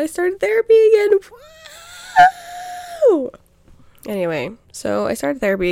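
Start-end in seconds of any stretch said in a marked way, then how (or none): 0.0-3.3 much higher and louder, bubbly; excited announcement. Real, not acted. Telling listeners she restarted therapy, excited and much higher. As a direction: burst out with the good news.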